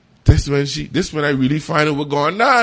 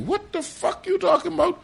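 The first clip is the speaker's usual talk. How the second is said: shocked disbelief. Retold or imitated. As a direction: voice jumps way up in disbelief. Disbelief at her accusation.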